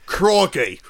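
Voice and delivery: mock Australian accent. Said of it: "vacillating between two different accents".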